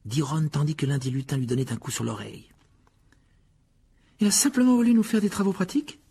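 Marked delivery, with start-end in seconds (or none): 4.2-6.0 incredulous Hermione. A narrator's reading. Hermione questioning, voiced higher.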